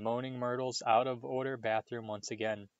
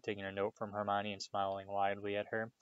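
usual speaking voice

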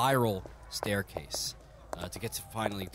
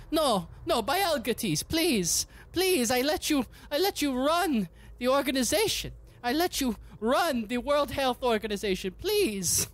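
much higher, louder frantic pleading; high ethnic voice; 6 dB louder than usual. Acted. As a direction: beg like your soul depends on it. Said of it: "Fausti go".

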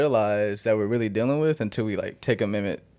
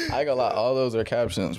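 normal talking voice

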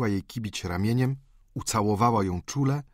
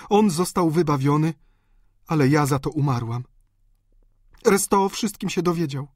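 lightly voiced anguished confession; a hint of a desperate woman; distraught countess; 7 semitones higher, 4 dB louder than usual. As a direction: tell it with rising feeling. Countess confesses selling the diamonds.

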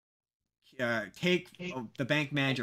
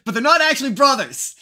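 high, loud protest, hammed up; retold bickering at full volume; mock exasperated insistence; 9 semitones higher, 11 dB louder than usual. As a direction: insist loudly in mock frustration. Acts out exasperated insistence in old bit.

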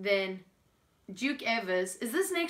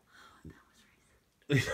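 whisper; 8 semitones lower, slower than usual. Real, not acted. Embarrassed aside after a joke.

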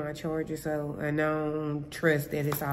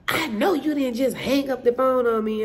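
mock indignant tenant; 6 semitones higher, 5 dB louder than usual. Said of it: "belligerent and rude".

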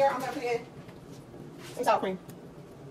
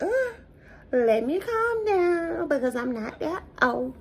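mock self-calming; 5 semitones higher, much slower than usual. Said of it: "I was like".

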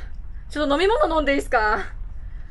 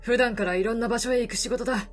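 put-on gruff weary older man, low; lower middle-aged man voice; 5 semitones lower, 6 dB quieter than usual. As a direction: speak low like a tired drifter. Voices the reporter.

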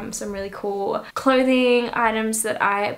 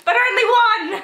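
excited; 12 semitones higher, 5 dB louder than usual. Real, not acted. Mock complaint, only one earring.

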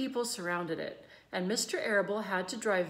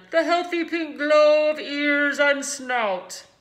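loud, booming PA speech; fair announcer; 6 semitones higher, 11 dB louder than usual. A narrator's reading. Announcer praising the pig to the crowd, loud. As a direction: proclaim it to the grandstand. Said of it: "continued the loudspeaker".

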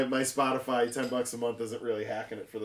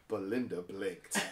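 mock dramatic reading; 5 semitones lower, 8 dB quieter, slower than usual. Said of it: "here's how they write it".

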